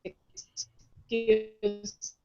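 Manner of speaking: ordinary talk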